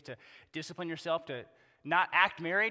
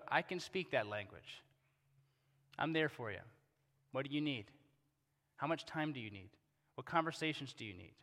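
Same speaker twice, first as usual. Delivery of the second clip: gentle supportive voice; 4 semitones lower, 6 dB quieter than usual. Retold or imitated. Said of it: "We need people who go".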